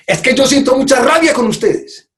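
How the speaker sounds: high, firm declaration of anger; owned anger. Shown as a demonstration. Speaker demos owning anger to the audience, high firm tone. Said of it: "ahora lo voy a decir desde acá".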